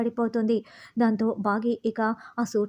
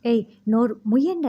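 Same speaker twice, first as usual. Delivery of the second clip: louder, barking shut up at the women; narrator's mock threat, louder; angry shut-up; 5 dB louder than usual. A narrator's reading. Terrorist silences the women.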